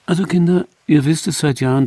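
a hint of a matriarch calling for attention; mother addressing family. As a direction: open a familiar announcement to the children. Old mother addresses family, acted.

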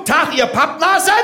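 high, barked drill-sergeant greeting; punchy parade-ground call; with punch. Shown as a demonstration. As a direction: fire it at the crowd after calling attention. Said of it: "mit Schmack. Ist okay, Achtung".

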